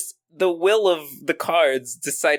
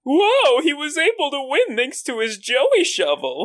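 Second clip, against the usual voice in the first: surprised whoa; 9 semitones higher than usual. Acted. Pegasus reacting, high excited.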